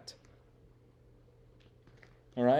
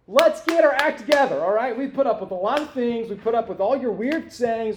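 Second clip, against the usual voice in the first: higher, louder, fed-up scolding; exasperated disciple; 5 semitones higher, 4 dB louder than usual. Acted. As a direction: berate him like a disciple losing patience. Disciples scold Jesus over money.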